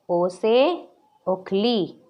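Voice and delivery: drawn out syllable by syllable, ओ से उखली; teacher stretching ओ से उखली for the class to repeat; slow model recitation. Shown as a demonstration. Teacher models the sound for children, very slow and clear. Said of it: "अब मेरे साथ आप लोग भी उच्चारण कीजिएगा".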